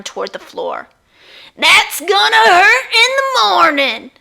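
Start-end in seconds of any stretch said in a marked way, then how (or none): 1.6-4.1 moan. Acted. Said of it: "she moaned".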